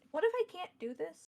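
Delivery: put-on anxious young king, voice lifting; nervous king. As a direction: blurt it out with rising doubt. Nervous king doubts himself.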